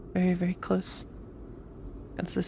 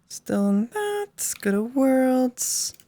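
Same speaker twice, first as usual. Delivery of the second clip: slow; 5 semitones higher, much slower than usual. Real, not acted. Navigating menus, dragging words.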